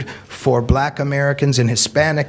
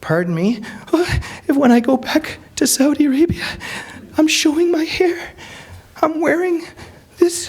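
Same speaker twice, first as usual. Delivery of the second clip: put-on much higher voice of the frightened girl; lightly voiced girl. The girl explains her fear of her parents, higher and nervous. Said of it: "And I'm like".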